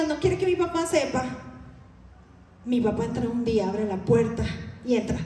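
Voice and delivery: ordinary delivery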